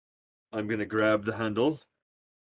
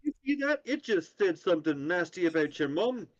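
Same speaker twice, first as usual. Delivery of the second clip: high mocking taunt; 8 semitones higher than usual. Goading the door, high pitched.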